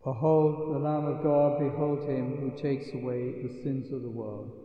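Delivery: ordinary talk, nothing marked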